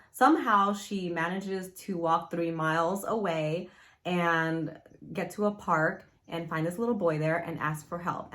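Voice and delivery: normal talking voice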